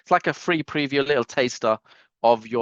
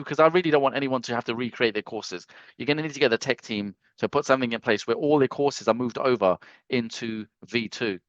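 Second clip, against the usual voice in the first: ordinary delivery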